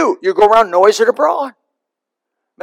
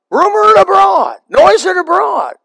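calling it out; 11 semitones higher than usual. Shown as a demonstration. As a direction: proclaim it like a town crier. Preacher models calling out the news.

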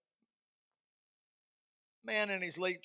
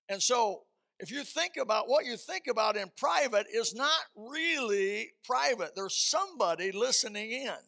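preaching; 3 semitones higher, 4 dB louder than usual. Acted. Preacher warning the congregation that God hears private thoughts, rising preaching. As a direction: drive the point home to the congregation.

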